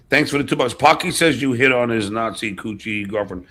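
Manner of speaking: ordinary talk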